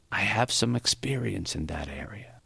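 put-on Maury, understated old teacher. A narrator's reading. Old man answering praise of him as a father, understated. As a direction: say it quietly, a hint of old age.

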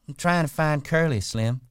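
wife claims she's hunting for Curly; high flirty wife. A narrator's reading. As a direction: toss it at Slim, all innocence. The wife answers Slim.

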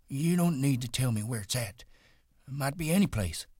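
reassure, eager to please; old Candy. Acted.

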